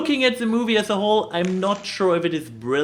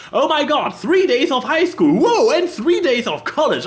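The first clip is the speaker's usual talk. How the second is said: high, loud gushing bee; playful hammed-up excitement; mock excited; 7 semitones higher, 4 dB louder than usual. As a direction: gush over it like a thrilled bee. Quoting the bees in the film.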